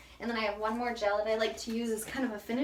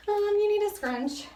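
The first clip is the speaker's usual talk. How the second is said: louder, higher insistence; emphatic correction; 10 semitones higher, 8 dB louder than usual. Real, not acted. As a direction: insist on it, loud and high. Correcting her instructions.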